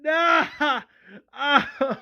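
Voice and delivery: high, loud acted sobbing; hammed-up anguished crying; crying out in grief. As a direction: wail for your dead child. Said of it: "Tyrese also cries".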